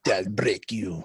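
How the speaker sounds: lightly voiced Russian boxer, low and cold; slow, cold menace in a Russian accent; Ivan Drago voice. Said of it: "Dolph Lundgren playing Ivan Drago".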